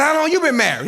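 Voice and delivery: high, loud offended question; indignant challenge. Retold or imitated. Comic snapping back at the old man, indignant. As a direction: fire it back at him. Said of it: "I done got mad at the man".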